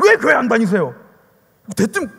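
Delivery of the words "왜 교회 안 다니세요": loud mock rebuke; imitated scolding. Evangelist rebuking a non-churchgoer, imitated scolding. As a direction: challenge them bluntly, as in a retelling. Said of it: "막 혼을 내고 막".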